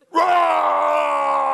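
long, loud high roar; sumo roar. Retold or imitated. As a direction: bellow like a charging sumo wrestler. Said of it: "It was like sumo, man. He was like".

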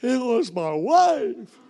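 slow, high rueful admission; husband admitting his wife hit him, slow and high; sheepish husband. Retold or imitated. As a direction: confess it was the wife. Voicing beaten husband.

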